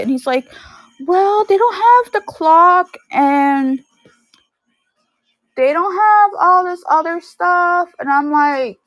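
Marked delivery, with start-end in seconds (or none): 1.1-7.9 high, slow, loud imitation of a whining child; frustrated kid. Little cousin complaining about what the movie left out, frustrated. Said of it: "like, all frustrated".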